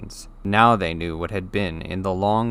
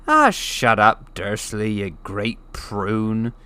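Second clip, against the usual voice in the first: slow, louder, scornful giant voice; dismissive giant; 5 dB louder, slower than usual. Acted. The giant tells Uncle Vernon to shut up, scornful. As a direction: wave the man off like a fly.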